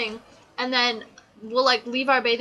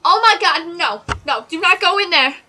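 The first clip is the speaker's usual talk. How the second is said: loud, high mock movie-theater yelling; mock panicked shouting at a film; imitated screaming; 6 semitones higher, 8 dB louder than usual. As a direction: yell at the screen, imitating rowdy viewers. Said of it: "And then they're screaming, they're like".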